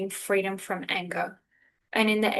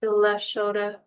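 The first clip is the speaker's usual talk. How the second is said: unhurried meditation guide with long pauses; calm, spacious guiding voice; guided meditation; 4 dB quieter than usual. Real, not acted. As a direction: ease them gently through breath and body, phrase by phrase.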